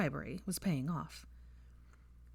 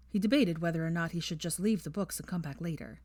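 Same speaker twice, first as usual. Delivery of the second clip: ordinary delivery, nothing marked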